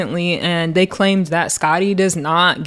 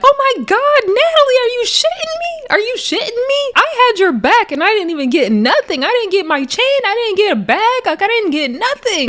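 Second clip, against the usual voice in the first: mock yelling; 13 semitones higher than usual. Teddy Baby yelling at Natalie's car, high mock shout. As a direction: screech it at a departing car. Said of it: "still yelling at the car".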